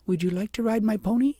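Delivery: high, sweet, repeating the offer; a child offering kindly with a smile; little girl asking princess again, smiling. A narrator's reading. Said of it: "with a heavenly smile".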